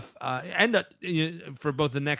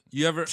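hiccup giggles; 4 dB louder, faster than usual. Real, not acted. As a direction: laugh that breaks into a hiccup. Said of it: "that's the best laugh I've ever heard".

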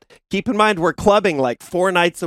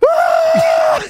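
very high, louder scream; mock scream; 4 dB louder than usual. Hitchhiker bracing his feet on the dash, mock scream. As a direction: shriek like you're about to crash. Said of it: "he just starts screaming".

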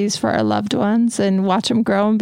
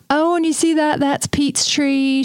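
put-on light Southern accent, higher and slow; 4 semitones higher, slower than usual. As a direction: mimic a Southern friend, drawn out in a Southern accent. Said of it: "That's my Southern voice".

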